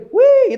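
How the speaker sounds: very high, loud squealed exclamation; hammed-up high yelp; mock squeal. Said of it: "Pasti teriak". (pekikan tinggi dibuat-buat)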